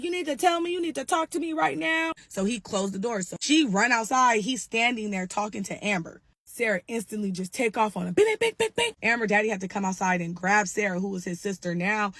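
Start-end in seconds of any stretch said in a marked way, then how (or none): 0.0-2.1 high shrill-free yell, pitched up, insisting; yelling demand. Retold or imitated. Sarah screaming demands at Alex. 8.1-8.9 high loud bing-bing punch noises; mock punch sounds. Imitating punches being thrown.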